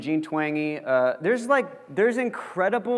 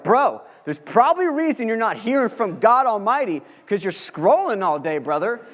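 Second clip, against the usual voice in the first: emphatic bro talk; 6 semitones higher, 5 dB louder than usual. Retold or imitated. Telling off the screen-addicted kid.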